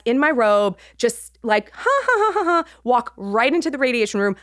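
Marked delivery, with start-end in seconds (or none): 1.7-2.6 high, breezy "ha ha ha"; carefree la-la noise. Retold or imitated. Patient waltzing past the check-in desk, carefree. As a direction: trill it obliviously, high and airy. Blithely waltzing into the wrong room.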